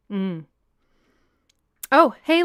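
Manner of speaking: ordinary delivery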